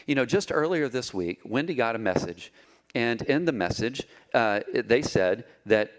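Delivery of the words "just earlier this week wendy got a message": ordinary talk, nothing marked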